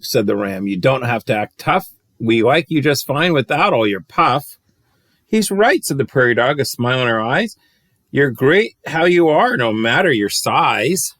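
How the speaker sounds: put-on lilting read-aloud rhyme for kids; bouncy rhyming storyteller voice; storybook reading. A narrator's reading. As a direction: read it like a bedtime picture book. Reads picture book aloud.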